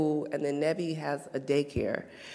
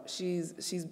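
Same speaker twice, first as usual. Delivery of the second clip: ordinary talk, nothing marked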